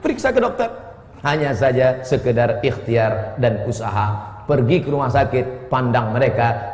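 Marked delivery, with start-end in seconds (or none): 0.0-0.7 squeaky high. Acted. Comic high-pitched aside in sermon.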